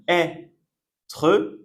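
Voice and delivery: ÊTRE slowly split as "è... tre"; syllable by syllable. Shown as a demonstration. Teacher introducing an important verb, "être" in slow syllables. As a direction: stretch "être" apart, opening on a clear "è". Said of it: "on prononce est".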